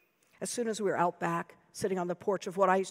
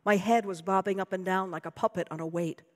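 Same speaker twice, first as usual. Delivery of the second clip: ordinary delivery, nothing marked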